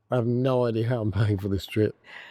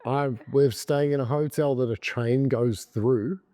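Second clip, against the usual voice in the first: normal talking voice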